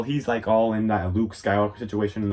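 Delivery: ordinary delivery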